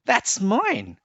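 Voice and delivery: high squeaky protest; squeaky squirrel. A narrator's reading. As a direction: squeak it out like a small indignant squirrel. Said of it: "squeaked the squirrel".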